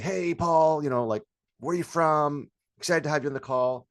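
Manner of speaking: high, loud, sing-out sales hello; bubbly greeting. Shown as a demonstration. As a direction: gush a chatty welcome to the client. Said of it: "So instead of saying".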